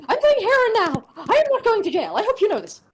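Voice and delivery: dramatic noblewoman. Acted. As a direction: declare it grandly. GM voices Lady Henning.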